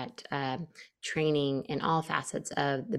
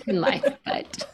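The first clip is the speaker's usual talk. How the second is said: a laugh breaks into the words; 7 semitones higher than usual. Real, not acted. Guest laughs at her late-puberty joke, laughing. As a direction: chuckle through it.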